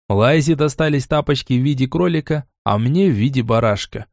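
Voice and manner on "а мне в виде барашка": ordinary delivery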